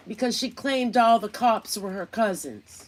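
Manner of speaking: ordinary talk, nothing marked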